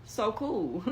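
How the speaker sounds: usual speaking voice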